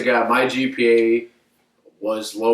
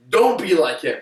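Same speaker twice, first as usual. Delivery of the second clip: retold angry coach; 6 semitones higher than usual. Coach berating him before team.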